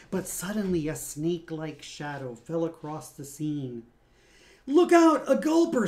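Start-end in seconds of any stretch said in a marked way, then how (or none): 4.6-5.9 high, loud warning yell; alarmed shout. Acted. Someone spots a gulper, high loud warning yell. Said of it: "someone cried".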